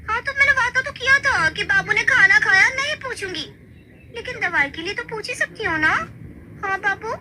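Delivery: high, sweet sly reasoning; cheeky wife. Acted. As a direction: wriggle out of the promise playfully. ([name] की ऊँची शरारती आवाज़)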